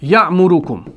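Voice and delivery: "यअमुरुकुम" with a sharp catch after "य"; hamza jerk demo. Shown as a demonstration. Teacher showing how it stands there, hamza jerk. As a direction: stop briefly at the hamza in "यअमुरुकुम". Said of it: "वहां पर है ऐसे".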